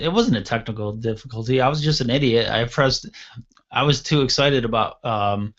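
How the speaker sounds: ordinary delivery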